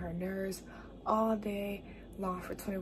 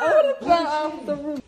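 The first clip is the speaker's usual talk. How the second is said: high loud excited yell; excited shout; 8 semitones higher, 15 dB louder than usual. Real, not acted.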